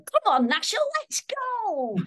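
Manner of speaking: high, excited Cockney cartoon boy; Cockney Dennis. Shown as a demonstration. Actor demonstrating the first Dennis voice, high Cockney call. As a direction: call out eagerly in a Cockney accent. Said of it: "with a bit of a Cockney accent".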